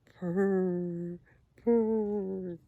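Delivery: long drawn-out purrs like a contented cat; gentle storybook cat purr. Acted. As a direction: purr it slowly, like the cat curled up and happy. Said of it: "And her cat says".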